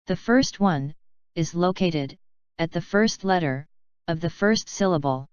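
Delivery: normal talking voice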